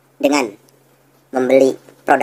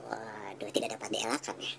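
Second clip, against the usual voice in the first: whisper; 4 semitones lower, 21 dB quieter, slower than usual. Acted. Mock reaction to claim.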